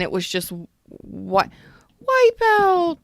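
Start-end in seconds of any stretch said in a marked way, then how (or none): 2.0-3.0 yell. Pretend. Shouting the song title.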